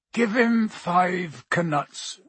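very slow, drowsy giant; sleepily. A narrator's reading. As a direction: drawl it out half asleep. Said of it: "said Hagrid sleepily".

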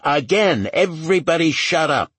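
loud, high-pitched command; exasperated, forceful call for silence. A narrator's reading. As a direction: raise your voice over the squabbling. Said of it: "Lucas raised his voice".

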